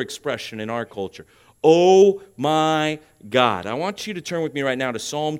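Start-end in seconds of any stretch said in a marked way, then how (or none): 1.6-3.7 slow, loud, word-by-word exclamation; drawn-out quote. Retold or imitated. Preacher quoting a popular expression in our culture, slow and drawn out. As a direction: stretch each word out for the congregation.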